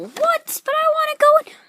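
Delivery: very high-pitched whiny figure; squeaky protest. Acted. Figure protests being put out.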